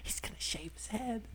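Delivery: unvoiced gossiping whisper. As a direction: whisper the news to a classmate. Student whispering aside.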